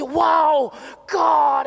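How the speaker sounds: loud, high awestruck cry; awed shout. Retold or imitated. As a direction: cry out in sudden awe. Awestruck at seeing God.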